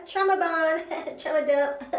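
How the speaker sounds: laughing, slowed down; chuckling through the words. Real, not acted. Laughing joke.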